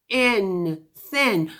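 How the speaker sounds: thin said with a long voiceless th; drawn-out thin. Shown as a demonstration. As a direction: stretch the th in thin, no buzz in the throat. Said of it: "Put your hands on your throat".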